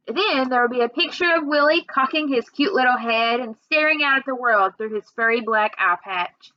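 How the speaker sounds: usual speaking voice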